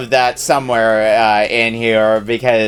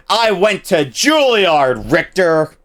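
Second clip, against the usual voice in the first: higher, slow, overacted plea; pleading dramatic actor; 6 semitones higher, slower than usual. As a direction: ham it up like a wounded actor. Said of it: "that's another good line".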